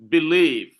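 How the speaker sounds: believe with an unstressed be- and a strong -lieve; don't insist on the start of believe, land on -lieve; stress on second syllable. Shown as a demonstration. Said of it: "you don't insist, insist on the B".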